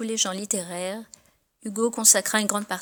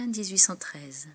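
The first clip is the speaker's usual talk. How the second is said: usual speaking voice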